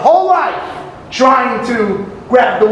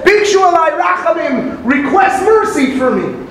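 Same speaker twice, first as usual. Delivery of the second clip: raised, pleading call for mercy; pleading cry; 6 semitones higher than usual. Acted. The penitent begging the sun and moon, pleading cry.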